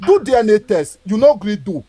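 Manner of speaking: ordinary talk, nothing marked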